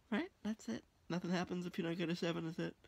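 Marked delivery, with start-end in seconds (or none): none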